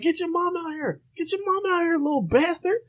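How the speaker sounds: high mock grandma yelling; angry grandma. Grandma demanding the mom, high mock yell. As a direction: squeal it up high like a furious grandma. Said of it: "she's like".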